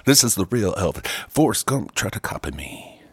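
a touch of [name], lightly voiced. Host answering as [name], a hint of the voice. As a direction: claim to be the real [name], half-hearted. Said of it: "You're like Forrest Gump [name] now".

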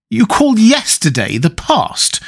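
disbelieving challenge to a friend; incredulous reproach. A narrator's reading. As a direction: question it as if appalled. Hallward reproaches Dorian in disbelief.

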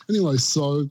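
ordinary talk, nothing marked

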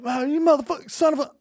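high-pitched mock outrage; hammed-up road-rage cursing; imitated angry driver. Acts angry drivers reacting.

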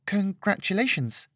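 high, hesitant congratulations; nervous. Acted. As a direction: say it shyly, unsure of yourself. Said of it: "she said nervously".

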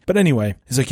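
ordinary talk, nothing marked

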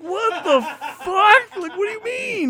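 high, shouted mock outrage; outraged disbelief. Him reacting to the cashier's insult, outraged high yell. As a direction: yell it incredulously, playing up the shock.

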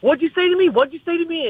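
high-pitched mimicked challenge, repeated insistently; mock confrontation. Rival trying to bully the friend, mock indignant high voice.

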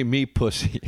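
"my" turned into "me". Shown as a demonstration. Correcting co-host's parody song, "me" said for "my". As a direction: say "me" in place of "my". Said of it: "you got to say".

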